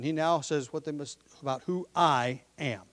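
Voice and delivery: usual speaking voice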